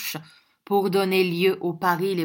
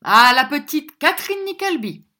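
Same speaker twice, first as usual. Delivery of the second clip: very loud, high rallying toast; boisterous cry to the company; shouted toast; 8 semitones higher, 10 dB louder than usual. A narrator's reading. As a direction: raise the glass with a shout. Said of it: "cria une voix derrière lui".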